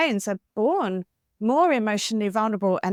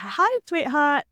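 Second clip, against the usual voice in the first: higher, slower, lightly cooed greeting; gentle greeter; 5 semitones higher, slower than usual. Retold or imitated. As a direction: sweet-talk the next little girl. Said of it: "the next one would be like".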